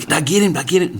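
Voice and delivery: a hint of a flustered hunter; mock nervous hunter. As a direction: play the rattled guy who missed. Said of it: "You know, like".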